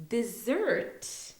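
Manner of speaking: DESSERT set apart as "di-ZERT"; careful DESSERT with a Z sound; stress on ZERT. Shown as a demonstration. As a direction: hit the second syllable of DESSERT. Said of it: "the way that we say it".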